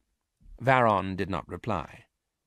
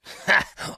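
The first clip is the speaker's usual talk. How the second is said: short scornful laugh; sharp laugh; 6 dB louder than usual. Acted. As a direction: bark a cold laugh. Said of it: "with a sharp laugh".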